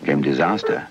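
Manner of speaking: usual speaking voice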